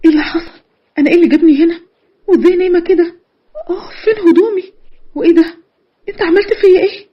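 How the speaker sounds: high-pitched, distraught questioning; panicked. Acted. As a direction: demand answers in rising alarm. Woman wakes, realizes she was assaulted.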